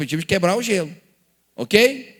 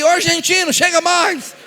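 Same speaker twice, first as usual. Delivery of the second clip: higher, louder teasing call; 10 semitones higher, 6 dB louder than usual. Retold or imitated. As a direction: shout the nickname across the room. Teens calling the nickname.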